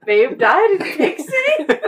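slow, high, laughing disbelief; incredulous laughing question. Real, not acted. Incredulous repeat of joke.

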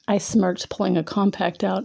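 ordinary delivery, nothing marked

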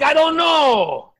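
yelled high and loud, slow; shouted I don't know. Retold or imitated. Shouting back at the interrogator, loud and slow. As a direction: shout it out, stretched. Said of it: "I'm like".

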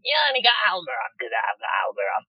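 squeaky, hurried mock whinge; whining little voice. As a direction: whine it quickly, mimicking a petty rival. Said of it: "your whining little voice saying".

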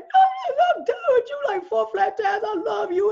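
very high, loud shouting retort; exploding at a partner in a public argument; heated retort. Retold or imitated. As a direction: yell it at him across the table. Heated argument with boyfriend in restaurant.

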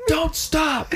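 high-pitched calling-out across water; mock shout. Friends yelling to a flagging swimmer, mock shout. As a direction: yell it high, hammed-up.

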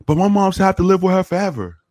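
put-on high, pleading kid voice; imitated pleading teen. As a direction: plead like a stuck teenager. Voicing the teen.

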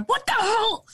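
high-pitched mock exclamation; mock outburst. Boyfriend yelling over Candy Crush next door, mock outburst. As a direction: imitate a gamer's outburst from the next room.

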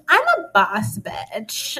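slow, high, lightly voiced sass; a hint of a swaggering diva; mock boss attitude. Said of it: "the one who's like".

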